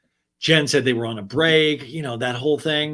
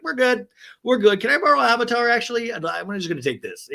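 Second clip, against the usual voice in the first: acted casual bystander; 9 semitones higher, 4 dB louder than usual. Acting out uninterested tape thieves.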